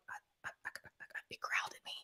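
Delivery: stammering, barely audible whisper; faint acted stutter, all breath; scared whisper. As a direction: act too shocked to get it out. Clammy, stammering to husband.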